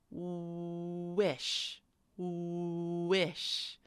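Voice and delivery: "wish" begun on a long oo; pure W sound. Shown as a demonstration. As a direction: hold "wooo", then finish "wish". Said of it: "We say wish".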